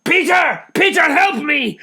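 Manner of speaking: high, loud strained call for help; desperate shout. Acted. Struggling to separate the men, calling Peter for help. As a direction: yell for help mid-struggle.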